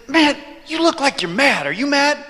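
high-pitched, accusing stranger; challenging stranger. Retold or imitated. Acting stranger challenging his look.

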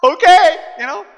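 loud, high whoop of agreement; eager acceptance. Pretend. Preacher welcomes God making him suddenly rich, mock eager.

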